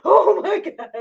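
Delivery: high, breathless exclamation; breath taken away. Retold or imitated. Patient losing her breath under pressure, winded exclamation. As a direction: gasp it out as if winded. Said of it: "it took my breath away".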